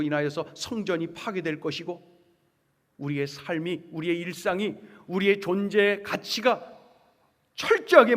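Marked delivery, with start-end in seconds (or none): none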